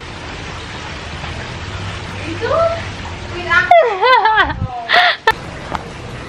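high, drawn-out giggling fit; long helpless laugh. Real, not acted. Vlogger cracking up with companion, high prolonged laughter. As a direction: burst into a long real laugh. Bursts out laughing mid-chat.

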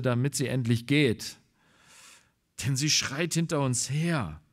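usual speaking voice

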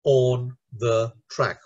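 slow, separated syllables in wheels on the track; measured sounding-out of four beats; word by word. Shown as a demonstration. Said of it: "four syllables again".

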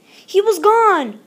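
high, slow dismay; shocked. A narrator's reading. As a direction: let the loss land slowly. Frog disappears, dramatic moment.

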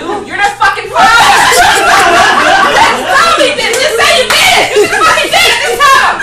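loud, high exasperated yell; hammed-up yelling, loud and high; shouting at husband. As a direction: shout it at him. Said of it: "I had to say to him".

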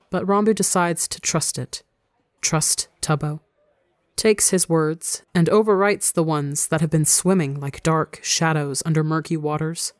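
ordinary delivery, nothing marked

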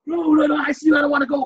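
high, loud pleading protest; panicked refusal. Acted. Patient begging staff not to send him to ICU, panicked refusal. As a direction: plead loudly against being taken away.

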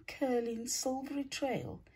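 ordinary delivery, nothing marked

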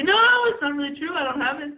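high, loud mock-humble denial; false modesty. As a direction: brush off the compliment in a pretend humble voice. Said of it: "I say".

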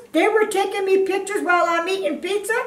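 high, fast, indignant question on were; can't believe it, rising; outraged disbelief. Real, not acted.

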